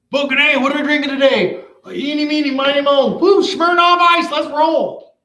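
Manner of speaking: high, giddy party-bro voice; sing-song the pick, then whoop it up; clueless beer buyer. Retold or imitated. Mocking a beer buyer picking Smirnoff Ice, high and giddy. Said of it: "What do you do when you buy beer".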